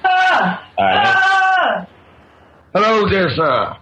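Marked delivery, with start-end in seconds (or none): 0.0-1.9 two long, high-pitched cries; high drawn-out "ah". Real, not acted. Caller greeting the radio hosts, high drawn-out cries. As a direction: cry out "ah" high and hold it.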